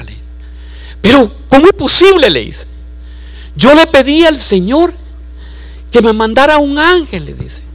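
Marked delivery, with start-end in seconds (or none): 1.0-2.3 high, mock-surprised woman's voice; astonished lady. Preacher voicing the amazed lady in a high voice. 3.6-7.2 high, mock-surprised woman's voice; astonished lady. Preacher voicing the amazed lady in a high voice.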